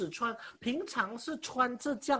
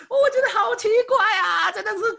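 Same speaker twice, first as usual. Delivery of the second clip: laughing exclamation; 13 semitones higher, 11 dB louder than usual. Real, not acted. Laughing at absurd memory.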